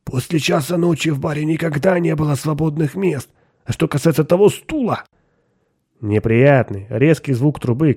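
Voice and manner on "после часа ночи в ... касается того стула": higher, anxious excuse; defensive and unsteady, pitched up; trembling self-justification. A narrator's reading. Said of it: "дрожащим голосом, как будто оправдываясь".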